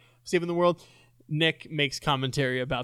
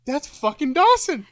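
high, louder retold exclamation; amazed realisation; 9 semitones higher, 5 dB louder than usual. Host retells looking up the actor, amazed outburst. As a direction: relive the moment of recognising the voice. Retells own reaction on finding out.

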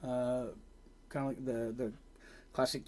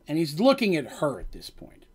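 ordinary talk, nothing marked

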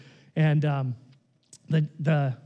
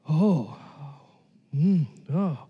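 put-on frail old man; 6 semitones lower, much slower than usual. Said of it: "he's kinda a frail, older guy".

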